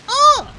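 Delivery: very high-pitched short cry; imitated character yelp. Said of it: "the male Saiyan with the voice I gave him".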